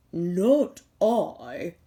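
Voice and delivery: a sleepy dog grumbling no; sleepy dog refusing. Acted.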